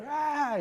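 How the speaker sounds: high mock screech; drawn-out pretend tantrum wail; imitated toddler scream. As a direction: scream like a child heard through a door. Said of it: "todavía se oye".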